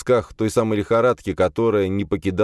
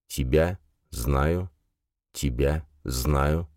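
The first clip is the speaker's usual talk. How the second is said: slow, softer, fading echo; 4 semitones lower, 3 dB quieter, much slower than usual. Acted. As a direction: echo fading away slowly. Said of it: "Отголовки слов заметались".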